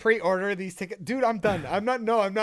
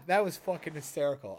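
ordinary talk, nothing marked

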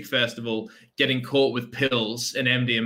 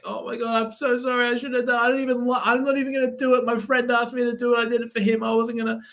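high, fast tearful pleading; mock tearful girl begging the police; crying girl; 11 semitones higher, faster than usual. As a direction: imitate her panicked apology, pitched way up. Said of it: "and she's, like, in tears".

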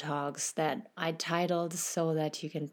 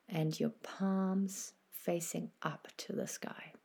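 guided meditation; 4 dB quieter than usual. Acted. Host guiding listeners through a body scan, soft meditation voice. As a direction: ease the listener into stillness, unhurried and gentle.

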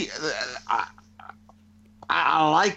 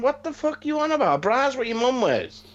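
higher-pitched mock disbelief; retold bafflement; 9 semitones higher than usual. Father retelling his retort to his son, mock bafflement. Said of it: "I'm like".

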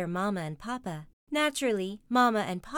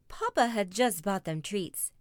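ordinary delivery, nothing marked

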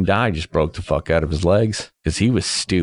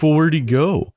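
puzzled question; 9 semitones higher than usual. Retold or imitated. Friends asking about missing companion.

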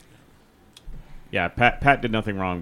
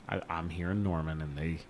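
low, hushed musing after a sigh; imagined private reflection; 8 semitones lower, 14 dB quieter than usual. Acted. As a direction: think it over to yourself, very soft and low. Imagines coach's thoughts after sighing.

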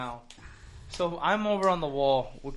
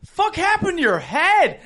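loud, high-pitched, drawn-out question; high mock yell of alarm; retold startled outburst; 11 semitones higher, 5 dB louder than usual. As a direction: blurt it out like you can't believe it. Acting out friend's startled question.

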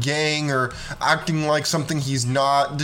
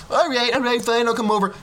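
high, mock reluctant girl voice; giving in girl; 7 semitones higher, faster than usual. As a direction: sigh and give in like a bored teen. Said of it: "girl giving in".

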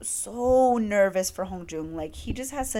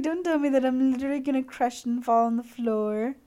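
higher, giddy and overwhelmed; giddy swoon; 4 semitones higher than usual. Retold or imitated. Fan swooning after idol says he did it for her, giddy.

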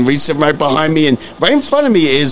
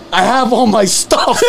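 slow, laughing through the homeless man's answer; laughing through quote; 4 semitones higher, slower than usual. Retold or imitated. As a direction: chuckle out the words. Retelling man's refusal while laughing.

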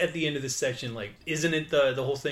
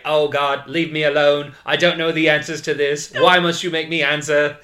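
louder, whiny pleading; mock exasperated robot; 7 dB louder than usual. Robot Mentor begging her to stop asking, mock exasperation. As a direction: beg to be left alone.

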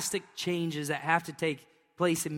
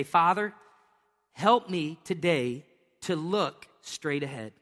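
slow, earnest prayer to God; spoken prayer; slower than usual. Shown as a demonstration. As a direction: pray it slowly, from the heart. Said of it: "But I'm just gonna say".

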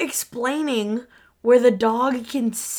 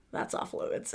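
much softer, nearly voiceless; quiet, quoted ruling; hushed aside; 13 dB quieter than usual. Retold or imitated. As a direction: mutter it like a house rule. Quoting Monk's house rules.

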